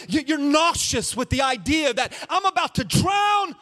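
full-throated sermon climax; preaching at full force. Acted. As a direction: whip the congregation up with the storm. Preacher building a storm image.

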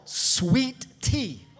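loud preacher's proclamation; exultant proclamation. Real, not acted. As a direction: proclaim it loudly to the congregation. Preacher exalts sweet tea, then repeats it.

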